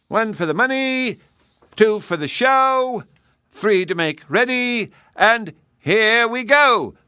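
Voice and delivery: high sing-song count, drawn-out final go; race count-off. Acted. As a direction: stretch the last words. Hare counts off again.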